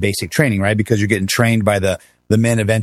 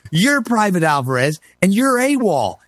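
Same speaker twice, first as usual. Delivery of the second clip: mock angry sergeant; 8 semitones higher than usual. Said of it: "Why are you so angry".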